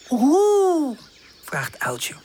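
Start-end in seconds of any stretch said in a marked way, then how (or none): 0.1-1.0 high questioning hoot; a puzzled owl's hoo; lightly voiced owl not understanding.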